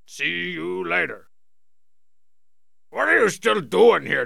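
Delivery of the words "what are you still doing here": annoyed shout. Acted. Annoyed at lingering character.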